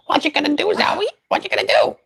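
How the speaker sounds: very high sing-song questions. Real, not acted. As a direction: chirp it at the bird. Talking to parrot Zoe.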